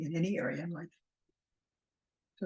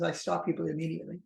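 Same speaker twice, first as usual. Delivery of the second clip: normal talking voice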